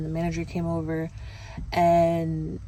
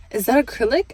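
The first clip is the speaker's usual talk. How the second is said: higher, louder disbelieving repeat; double take; 5 semitones higher, 5 dB louder than usual. Retold or imitated. Customer double-checking her toes, stunned rising question. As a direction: ask again in disbelief, rising. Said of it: "did a double take".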